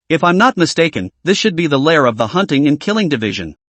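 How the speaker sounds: ordinary delivery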